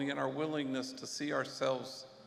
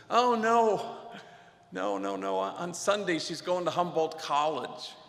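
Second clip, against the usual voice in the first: a quick, higher-pitched and louder no, no; eager reassurance; 3 semitones higher, 5 dB louder than usual. Retold or imitated. Father reassures the priest his daughter is fine, eagerly. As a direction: brush off the worry, then share the news proudly.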